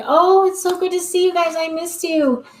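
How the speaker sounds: high, gushing hello to the dogs; excited homecoming greeting. Shown as a demonstration. Owner greeting her dogs at the door, excited. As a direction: greet them like you've missed them all day. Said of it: "I have to do it in a calm voice because if I get too amped up".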